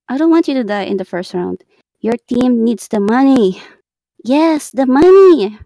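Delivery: loud, insistent plea; emphatic pleading. Real, not acted. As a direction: press the point hard.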